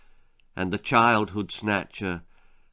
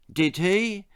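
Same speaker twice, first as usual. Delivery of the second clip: puzzled; 7 semitones higher than usual. A narrator's reading. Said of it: "who was a little puzzled".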